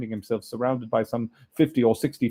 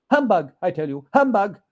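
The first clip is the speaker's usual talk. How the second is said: defiant humbug; 7 semitones higher, 7 dB louder than usual. Acted. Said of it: "the spirit raised a frightful cry".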